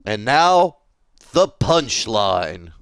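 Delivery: slow, drawn-out reveal after a pause; pause, then stretch out the last word; theatrical, slow build to the reveal; dramatic pause. Acted. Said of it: "Pause for dramatic effect".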